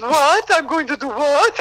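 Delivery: squeaky incredulous laughing shout; stunned disbelief. Retold or imitated. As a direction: play the actor thrown a surprise role. Joselito stunned by new role.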